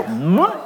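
mock gulp bringing up cud; cow regurgitating noise. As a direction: imitate a cow bringing food back up. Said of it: "They go".

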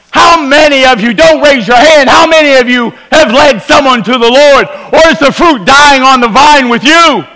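high, pressing preacher's challenge; preaching at full force. Acted. Pastor challenging the congregation to go out soul winning, preaching hard. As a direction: drive it home from the pulpit.

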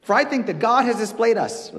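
loud, mocking sarcasm, high and pointed; reading Paul's words as a loud sarcastic dig. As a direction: jab at the proud listeners with mock praise. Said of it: "Everything he says here is sarcastic".

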